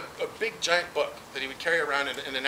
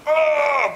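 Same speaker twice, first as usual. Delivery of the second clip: a sudden loud high yelp; 9 semitones higher, 6 dB louder than usual. Retold or imitated. Classmate whooping while slamming a card on the table. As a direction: bark it out as you slam the card.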